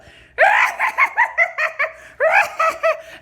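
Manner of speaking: loud, very high hyena cackle. Retold or imitated. Wife imitating her husband's laugh at the pregnancy, manic cackle. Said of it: "this is like a hyena on drugs".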